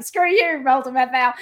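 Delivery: retold yell. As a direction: fling it at him like a taunt from a passing car. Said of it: "saw him and just yelled".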